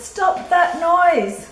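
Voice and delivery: loud, high, slow shout. Acted. Farmer shouting out the window at the animals, loud slow shout. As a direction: bellow it out the window. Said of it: "He opened the window and shouted".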